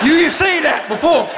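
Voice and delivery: indignant outburst. Retold or imitated. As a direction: call him out for holding back. Accusing the funeral director.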